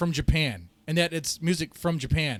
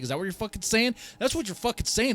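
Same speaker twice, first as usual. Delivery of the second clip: heated rant; 6 semitones higher, faster than usual. Real, not acted. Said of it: "I'm getting heated here".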